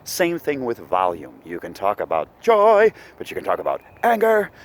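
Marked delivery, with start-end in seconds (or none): 2.4-2.9 loud. Shown as a demonstration. Teacher demonstrating extreme volume, loud. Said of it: "both work for an extreme volume". 4.0-4.5 loud high anger. Shown as a demonstration. Teacher demonstrating extreme volume, high loud shout. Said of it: "both work for an extreme volume".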